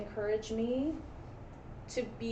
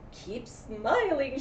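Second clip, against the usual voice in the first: lively storybook read, loud cries of no; dramatic read-aloud to a class; story reading; 7 semitones higher, 6 dB louder, much slower than usual. A narrator's reading. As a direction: read the rhyme with big swings for kids.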